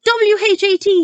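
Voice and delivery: very high, loud squeal. Acted. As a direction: squeal the letters in pain. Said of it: "he squealed".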